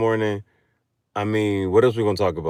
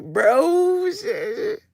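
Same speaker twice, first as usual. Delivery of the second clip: much higher squealing woman with a cry; mock squeal; 4 dB louder, much slower than usual. Shorty startled in the story, squealing. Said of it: "shorty was like".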